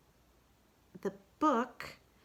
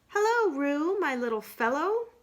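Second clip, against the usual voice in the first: higher, louder friendly animal voices; Rabbit and Piglet greeting; 9 semitones higher, 5 dB louder than usual. Acted. Friends greet Roo cheerfully, higher and louder. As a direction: sing out a bright hello to little Roo. Character voices in read-aloud.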